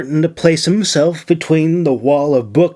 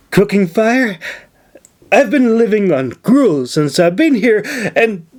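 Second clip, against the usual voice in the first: blubbering; 7 semitones higher than usual. Acted. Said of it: "she blubbered".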